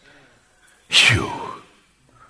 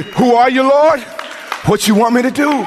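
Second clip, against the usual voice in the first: high, loud prayer cry; 10 semitones higher than usual. Shown as a demonstration. Preacher urging listeners to pray, loud high cry. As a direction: cry it out higher and louder, pleading.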